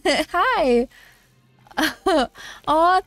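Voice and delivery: ordinary talk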